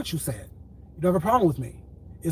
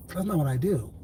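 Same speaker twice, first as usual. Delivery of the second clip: ordinary talk, nothing marked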